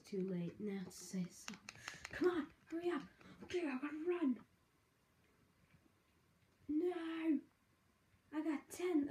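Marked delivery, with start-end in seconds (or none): 2.1-9.1 high-pitched, frantic hurrying; urgent. Real, not acted. Player racing the last seconds, urgent and high. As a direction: urge it on in a raised, panicky pitch.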